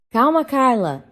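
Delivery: high, loud urgent shout. A narrator's reading. Husband shouts to calm his pregnant lover. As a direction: call her down in a rush. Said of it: "gritou Pedro".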